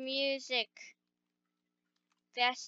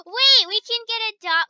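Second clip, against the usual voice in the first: excited; 7 semitones higher, 6 dB louder, faster than usual. Real, not acted. Sudden idea after gasp.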